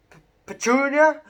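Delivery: high, loud gasping stammer; gasped. A narrator's reading. Vernon gasps his wife's name in shock, high and loud. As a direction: choke out her name in shock. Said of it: "he gasped".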